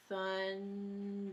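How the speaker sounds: "thundering" stretched very slowly, thun-der-ing; drawn-out syllables. Shown as a demonstration. Teacher offering a word to count, "thundering" slowly sounded out as thun-der-ing. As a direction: draw out each of the three beats in "thundering". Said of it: "how many syllables are in thundering".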